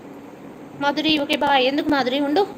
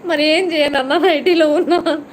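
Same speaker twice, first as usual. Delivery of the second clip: louder, laughing protest; laughing excuse; 4 semitones higher, 7 dB louder than usual. Real, not acted. Streamer explaining nightie to viewer, laughing loudly.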